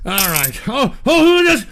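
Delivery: ordinary delivery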